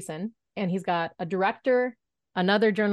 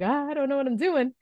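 higher-pitched, mildly flustered retold panic; panicked intern; 7 semitones higher than usual. Frozen intern to the news room, mock panic. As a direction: act out a flustered moment of not knowing. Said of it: "I kind of freeze".

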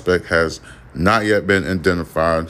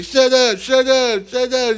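very high shrieking mock yell; angry shut up. The man yelling back at the kids, high shrieking mock yell. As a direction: shriek it like he's losing it. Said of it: "He like".

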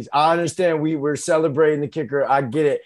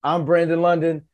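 ordinary talk

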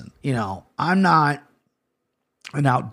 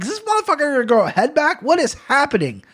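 much higher, fast and alarmed; freaked-out disbelief; 11 semitones higher than usual. Retold or imitated. Retelling his disbelief at the cut snake still moving, high and alarmed. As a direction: yelp it like you can't believe your eyes.

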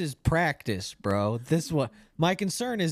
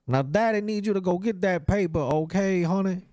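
pimp voice, pitched up and sweet-talking; sleazy pimp voice; 5 semitones higher than usual. Retold or imitated. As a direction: coax her like a sleazy pimp. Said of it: "just a dude in an Uber".

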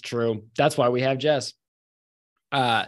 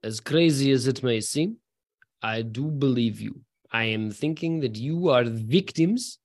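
lower, slow, put-on Russian accent; 3 semitones lower than usual. Game master voicing the mercenary to the party, in a Russian accent. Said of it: "says in his very good Russian accent".

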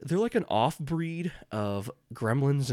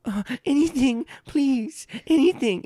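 much higher, loud begging goblin; groveling goblin; 8 dB louder than usual. Acted. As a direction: plead high and desperate. Goblins grovel before queen.

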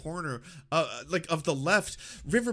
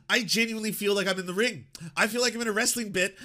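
ordinary talk

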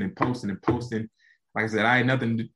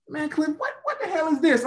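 much higher teasing voice for the teammates; mocking teammates. Teammates at the facility teasing Cliff, high mocking voice. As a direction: rib him like a mocking teammate. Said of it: "they were mocking it".

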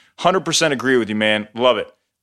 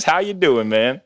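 normal talking voice

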